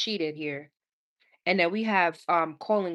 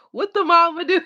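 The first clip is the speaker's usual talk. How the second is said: mock question; 11 semitones higher, 8 dB louder than usual. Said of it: "I'm like".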